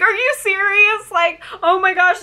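high-pitched retold shock; reenacting an overwhelmed reaction; tearful disbelief. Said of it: "I just started crying".

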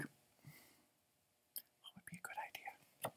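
slow hushed whisper; whispered thinking. Acted. Jessica pacing and wondering, whispered. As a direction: breathe the question to herself. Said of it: "She's trying to think".

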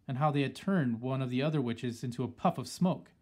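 ordinary delivery, nothing marked

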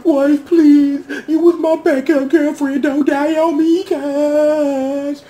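high, loud hammed-up plea; mock pleading Yuna. Viewer voicing Yuna begging his dying girl, high mock plea. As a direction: beg like a desperate boyfriend. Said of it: "new Yuna over here, like".

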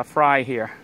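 normal talking voice

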